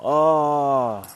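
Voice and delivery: a loud, high "Oh" before teasing the audience; playful exclamation. Real, not acted. Teasing his viewers before they get mad, loud and high exclamation.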